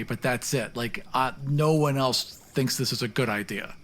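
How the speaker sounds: usual speaking voice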